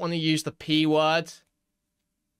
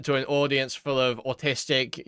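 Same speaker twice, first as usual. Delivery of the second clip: ordinary delivery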